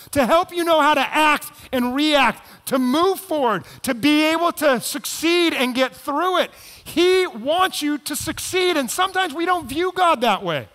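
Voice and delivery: preaching at full force. Acted. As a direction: drive it home to the congregation.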